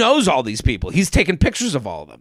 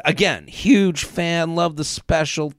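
ordinary talk

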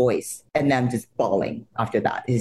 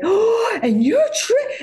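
high, louder starstruck squeal; 4 dB louder, slower than usual. Retold or imitated. Fan recognizing a soap star, squealing with excitement.

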